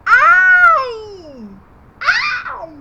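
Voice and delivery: high, loud "ouch" yelps; hammed-up squeal of pain; mock cry of pain. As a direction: yelp like a finger got stepped on. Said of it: "o que que a letrinha A falou".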